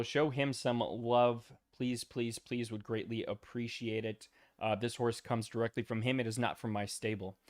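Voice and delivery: ordinary talk, nothing marked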